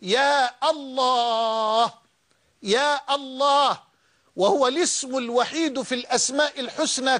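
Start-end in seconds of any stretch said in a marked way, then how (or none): 0.0-3.8 very slow, drawn-out imploring يا الله; slow devotional invocation; fervent calling on God. Shown as a demonstration. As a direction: stretch يا long, like a heartfelt plea. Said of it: "فأنت تقول مثلًا".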